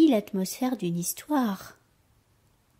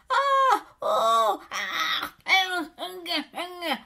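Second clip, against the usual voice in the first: loud yells turning to strangled grunts; acted choking grunts; struggling cries and grunts; 6 semitones higher, 5 dB louder, much slower than usual. A narrator's reading. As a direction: cry out while being throttled. Victim grabbed, shaken and strangled.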